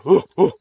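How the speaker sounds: a loud, rising ooh ooh hoot; pig call demo. Shown as a demonstration. Hunter showing viewers the call, loud ooh hooting. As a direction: show the hooting call you use in the cane. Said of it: "do that sound I was doing".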